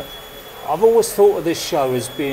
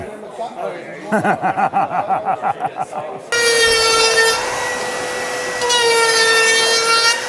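long high laugh. Real, not acted. Big laugh at plugged-in tool.

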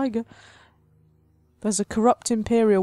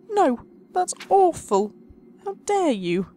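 ordinary talk, nothing marked

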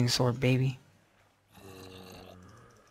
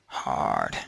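deep voice. Pretend. Deep voice for emphasis.

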